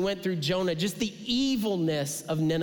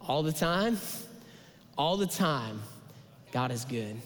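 softer and lower each time; call and response refrain; 5 semitones lower, 3 dB quieter than usual. Real, not acted. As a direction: fade into a quiet affirmation.

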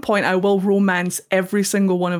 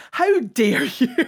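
slow, higher-pitched playful indignation; mock outrage; 4 semitones higher, slower than usual. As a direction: draw it out, scandalised in jest. Mock outrage at game studio.

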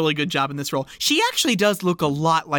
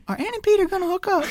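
eager student; 14 semitones higher, faster than usual. Retold or imitated. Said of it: "she's like".

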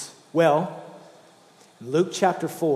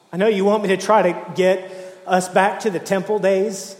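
louder, higher, impassioned voice of Jesus; full-force preaching; 3 semitones higher, 5 dB louder than usual. Acted. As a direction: proclaim it like a fiery preacher.

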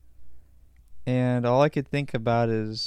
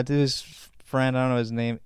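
normal talking voice

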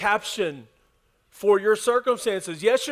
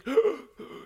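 gasp for air; 7 semitones higher than usual. Retold or imitated. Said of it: "you can't get your breath".

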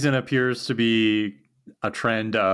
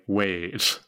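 low, abrupt shift from excitement to a warning; sudden caution; 5 semitones lower than usual. Retold or imitated. Co-host imitating Dawn's sudden turn, lowered and wary. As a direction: drop the voice as if catching herself. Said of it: "pivots from excited to, like".

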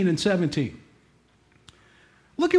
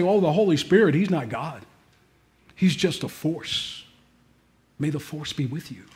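low, soft dismissive mimicry; lightly mock the doubters' claim; mocking quote; 6 semitones lower, 5 dB quieter than usual. Preacher mimicking denominations that deny the Spirit, mocking. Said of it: "there's some that'll say".

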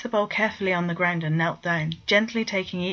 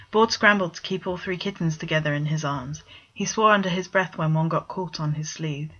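plain reading voice

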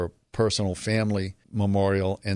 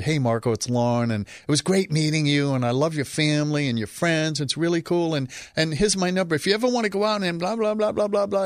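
put-on higher bubbly girlish phone message, rushing into blah blah; imitating Lauren's message; 7 semitones higher than usual. He voices his future wife's phone message in a higher chirpy voice. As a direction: play the chirpy girl leaving her number. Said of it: "She left a message".

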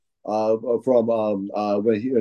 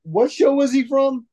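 high-pitched put-on casual questioner; Rooker. Actor quizzing fans waiting in line, high imitated voice.

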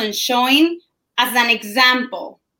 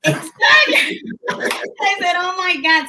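high, excited burst breaking into laughter; laughing agreement; 8 semitones higher, slower than usual. Real, not acted.

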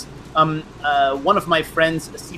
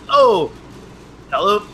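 flustered surprise; 5 semitones higher than usual. Retold or imitated. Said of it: "I was totally blindsided".

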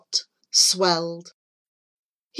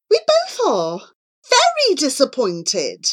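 higher, stressing the disappointment; lightly voiced mother, pitched up; disappointed mum; 7 semitones higher than usual. A narrator's reading. Mum telling Charlie they are both let down, disappointed. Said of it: "said Charlie's mum".

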